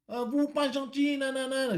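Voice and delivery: high, very slow broken French with a put-on foreign accent; mock foreign accent. Driver mimics the customer refusing to pay, slow mock foreign accent. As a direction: drag out her protest in a foreign accent. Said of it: "je vous fais l'accent".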